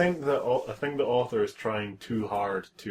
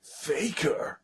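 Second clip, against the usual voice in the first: whispered game quote; 5 semitones higher, 4 dB quieter than usual. Retold or imitated. Quoting Shadow game line.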